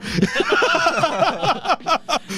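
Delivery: long high-pitched laughing fit; real high laughter at a joke; cracking up. Real, not acted. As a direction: burst into helpless high laughter.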